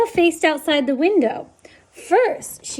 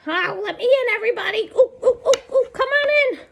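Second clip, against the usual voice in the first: high sing-song fox cooing ooh; 9 semitones higher, faster than usual. Acted. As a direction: coax your way in with playful high ooh sounds.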